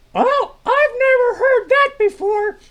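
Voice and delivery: very high, loud, drawn-out character voice; high-pitched puppet sidekick. Acted. [name] answers Milt's greeting in a very high sidekick voice. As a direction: squeak it out slowly and brightly.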